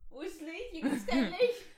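giggling through the words; laughing, trying to sneeze. Real, not acted.

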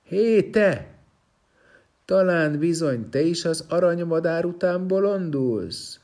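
high, loud jeering call; mocking shout. Stone man taunts the prince passing by, loud mocking shout. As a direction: jeer at him as he walks past. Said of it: "az is utána kiáltott".

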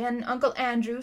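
ordinary delivery

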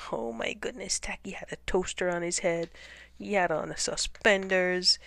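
ordinary delivery, nothing marked

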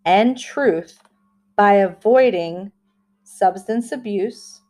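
slow, deliberate affirmation reading. Shown as a demonstration. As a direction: read each phrase slowly with pauses. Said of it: "I will say it slowly like I always do".

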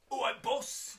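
very high, loud cockney greeting; cheeky cockney lad. Acted. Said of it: "Kyle is a bit cockney".

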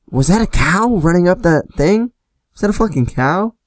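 high-pitched, rising disbelieving questions; mock incredulous. Cop making fun of the fleeing friend, mock incredulous. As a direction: act baffled by what you just saw. Cop mocking the clumsy runner, retold.

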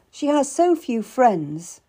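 demur, drawing it out; reluctant. A narrator's reading. Cat pities the queen, reluctant. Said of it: "I said reluctantly".

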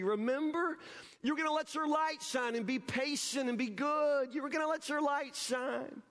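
a mother reminding her upset daughter, a hint of high pleading; lightly voiced frustrated mom. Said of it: "Mama pulled the little girl aside and said".

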